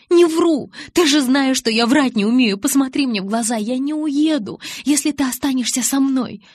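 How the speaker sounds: high, loud frantic begging; desperate pleading. Acted. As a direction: beg him not to go. Bella begs Jacob to stay.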